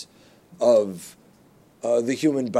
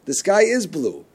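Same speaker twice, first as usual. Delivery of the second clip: higher and louder, delighted wonder; amazed; 10 semitones higher, 6 dB louder than usual. Retold or imitated. Wife marvelling at the blue sky on landing, higher and louder. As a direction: marvel at it like a child. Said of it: "Chani said to me".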